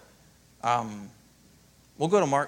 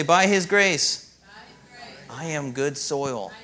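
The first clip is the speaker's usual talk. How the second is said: slow, louder declaration; call for response; 6 dB louder, much slower than usual. Shown as a demonstration. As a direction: lead the congregation slowly.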